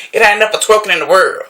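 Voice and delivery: usual speaking voice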